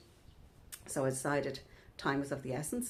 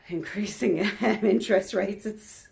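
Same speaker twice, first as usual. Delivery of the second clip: louder, with a real laugh breaking through; 5 semitones higher, 9 dB louder than usual. Real, not acted. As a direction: laugh at how absurd it is. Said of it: "makes me laugh".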